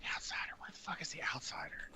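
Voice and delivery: hushed, baffled whisper. Real, not acted. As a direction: whisper it in disbelief. Whispered disbelief.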